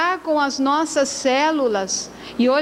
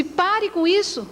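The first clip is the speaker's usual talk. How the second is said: high, slow, exasperated command; acted scolding from a worn-out mother; irritated mother; 7 semitones higher, slower than usual. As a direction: order it to stop, pitched well up. Tired mother snaps at singing daughter.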